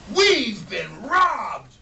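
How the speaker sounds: loud, slow, furious "We've been robbed!"; angry outcry. Acted. Mr Smith discovering the empty shelves, slow furious bellow. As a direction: bellow it in outrage. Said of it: "Mister Smith got angry and said".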